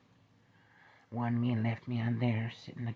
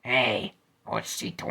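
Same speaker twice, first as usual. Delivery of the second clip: plain reading voice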